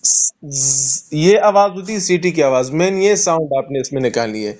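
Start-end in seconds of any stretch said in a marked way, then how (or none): 0.0-1.1 a sharp ss hiss through the teeth; whistling hiss. Shown as a demonstration. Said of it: "آپ کے سامنے کیا".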